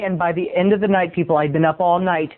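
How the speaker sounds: usual speaking voice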